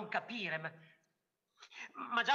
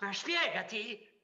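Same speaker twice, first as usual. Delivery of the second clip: shouted; slower than usual. A narrator's reading.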